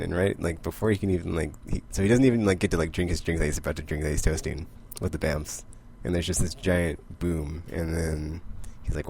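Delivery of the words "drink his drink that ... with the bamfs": ordinary talk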